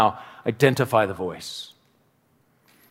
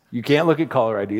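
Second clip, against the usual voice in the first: ordinary talk, nothing marked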